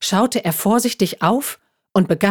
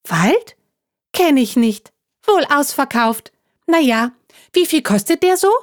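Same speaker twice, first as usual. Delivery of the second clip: higher, chatty little talking juice box; chirpy juice pack; 8 semitones higher than usual. Acted. Juice pack explaining itself to the hedgehog, chirpy and higher. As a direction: bounce along like a cheerful carton.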